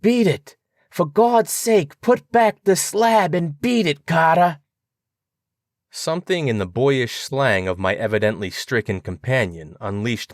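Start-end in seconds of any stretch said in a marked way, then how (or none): 0.0-4.6 high, terrified plea from below; desperate high-pitched warning cry; piteous cry. A narrator's reading. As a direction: beg him in panic to flee. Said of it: "a piteous cry from Warren".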